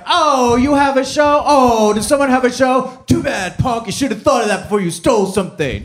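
high, sing-song sneering taunt; mocking cop. Cop mocking the arrested kid, high sneering taunt. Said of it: "they're just being jerks".

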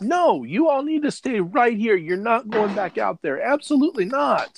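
high, fretful mom scolding her lying son; scolding mother. Acted. Mother scolds son who was missing for days, high fretful voice. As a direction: nag him like a worried mother catching a lie.

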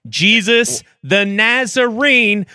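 building to a preacher shout; preaching. Pretend. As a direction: revival preacher voice, rising.

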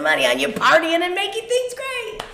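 rising into a very high squeal; hammed-up enthusiasm. Describing lavish corporate parties, gushing high-pitched enthusiasm. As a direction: lift it way up, comically gushing. Mocking corporate party spending.

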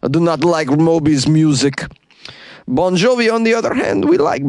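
put-on a light Russian accent; mock Russian accent. Host imitating a Russian jailer with a light Russian accent. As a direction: say it like a grumpy Russian guard, lightly. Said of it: "fun to say with a Russian accent".

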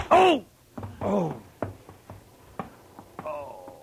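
sharp high cry of pain dying away into a groan. Acted. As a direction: hit on the head and going down. Struck on the head.

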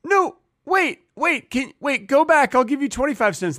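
put-on high, urgent kid backpedaling; a kid frantically trying to undo the deal, way up high; panicked kid. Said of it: "And I'm like".

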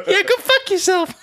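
high squeaky cartoon mouse; Minnie Mouse voice. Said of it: "Minnie's like".

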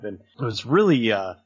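usual speaking voice

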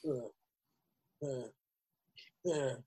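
imitating a tech metal tour manager at a loss; mock stammering tour manager. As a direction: mumble like a clueless manager caught out. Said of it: "tech metal tour managers that's kinda like".

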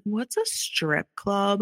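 lightly imitated little boy's question; slow, innocent child's question; four-year-old son. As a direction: imitate a curious small child, drawing the words out. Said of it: "our four-year-old son turned to me".

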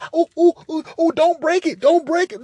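high, pleading whine of a scared partner; pleading mock voice. Pleading with the woman, high whiny plea.

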